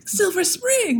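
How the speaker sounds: high, lit-up recognition; excited. Retold or imitated. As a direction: say it like a thrilled stranger.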